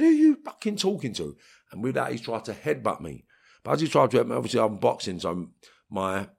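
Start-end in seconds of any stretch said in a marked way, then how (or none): 0.0-1.3 angry challenge. Retold or imitated. Squaring up to the officer.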